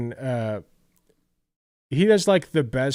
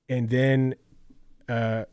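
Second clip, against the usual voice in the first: boring monotone; 2 semitones lower, slower than usual. Retold or imitated. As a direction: drone through it slowly like a bored reviewer. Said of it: "they just sit there monotone".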